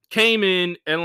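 ordinary delivery

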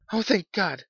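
high, loud cry of thanks; fervent relief. A narrator's reading. Old woman clasping her hands, fervently relieved. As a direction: cry out as fear lifts.

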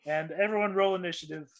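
ordinary talk

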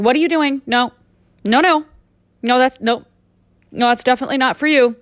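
scolding a pet. Acted. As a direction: catch the cat misbehaving and scold it. Acting out scolding the cat.

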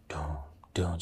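soft whispered dun dun like slow drums; whispered drumbeat. Retold or imitated. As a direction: breathe out the drumbeat softly. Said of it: "just slow drums".

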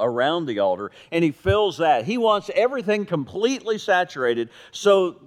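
ordinary talk

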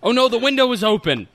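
panicked. Pretend. Mock panic in character.

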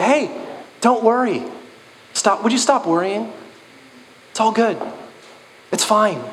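high, light brush-off voice; breezy dismissive reassurance. Retold or imitated. A friend breezily brushing off someone's real pain. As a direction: wave the worry away in a bright high voice. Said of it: "someone will come along and go".

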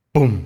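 sudden loud "pum"; door slam noise. A narrator's reading. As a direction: boom it out like a slamming door. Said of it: "cerró la puerta con un". (portazo fuerte)

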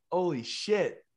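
loud, high, amazed exclamation; acted sudden realization. Traveller wakes over the Atlantic with an idea, acted amazement. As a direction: blurt it as the idea finally clicks.

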